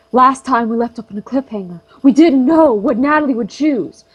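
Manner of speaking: usual speaking voice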